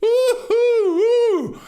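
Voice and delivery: very high, loud squeals of delight; thrilled ooh. Real, not acted. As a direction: squeal with real excitement at the donations. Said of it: "Ooh, I'm getting chills".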